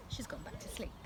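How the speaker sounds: hushed whisper so as not to wake her. A narrator's reading. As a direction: breathe it quietly. Narrator hushes for sleeping mum.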